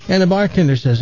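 normal talking voice

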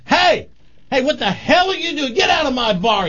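high-pitched mock outrage, acted out; outraged bartender. Bartender throwing two drunks out, mock outrage. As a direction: play an angry barkeep, pitch shooting up.

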